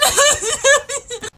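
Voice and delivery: loud, high-pitched hammed-up bawling; imitated child's hysterical wail; mock sobbing. Five-year-old still bawling after ten minutes, mock sobbing. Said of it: "I start sobbing".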